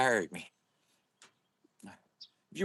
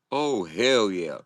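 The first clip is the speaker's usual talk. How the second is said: ordinary talk, nothing marked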